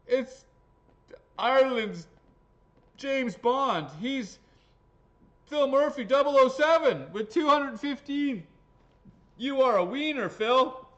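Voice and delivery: loud, high announcer shout; hyped winner announcement. Acted. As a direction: belt it out like a ring announcer.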